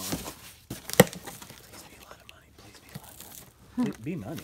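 hushed, whispered plea; whispered wish. Real, not acted. Seller wishing the box holds money, whispered wish. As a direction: whisper it like a private prayer.

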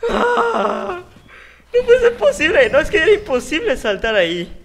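high, loud laughing disbelief; despairing laugh. Real, not acted. Gamer laughing in despair after dying, high and loud. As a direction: laugh off the failure in shrill disbelief.

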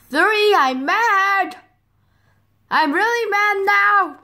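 high, stretched-out warning; acted anger. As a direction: count up to a hammed-up outburst. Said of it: "I'm getting mad".

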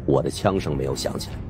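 ordinary delivery

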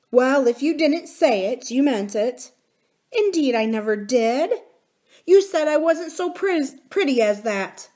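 a nurse still stung by the insult; hurt nurse. A narrator's reading. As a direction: voice changed, reproachful and wounded. Nurse reproaches.

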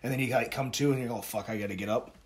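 soft, low, drained voice; defeated self-talk. Retold or imitated. Lifter zones out in car outside the gym, quietly defeated.

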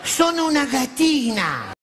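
high, slow kittenish coo; cutesy girly voice. Retold or imitated. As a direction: purr it like a sweet kitten. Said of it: "hanno tutte quella voce".

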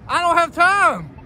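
high, loud, drawn-out protest; mock-exasperated shout. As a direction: whine loudly at the crossing signal, in play. Arguing back at crosswalk signal.

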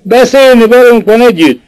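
urgent hail to a man above; calling out. A narrator's reading. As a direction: keep calling him upward. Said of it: "Kiáltott utána".

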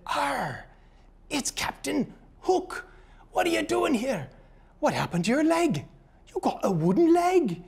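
high, hammed-up pirate accent with a gruff arr. A pirate in the bar greeting Captain Hook in a pirate accent. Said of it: "do a little accent".

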